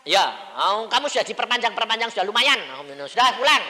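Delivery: loud, high, hurried telling-off; blunt insistence. Retold or imitated. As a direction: push the point at full volume. Dialog keras soal waktu pulang. (desakan keras bernada tinggi)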